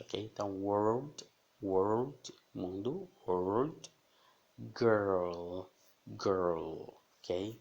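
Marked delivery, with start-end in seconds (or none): 0.6-3.9 world with a dark L at the end; world, curling the tongue for the L; clear dark L in world. Shown as a demonstration. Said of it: "é bem evidente". 4.8-6.9 put-on girl ending in a dark L; girl, hold the L at the end; clear dark L in girl. Shown as a demonstration. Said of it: "o L é bem evidente".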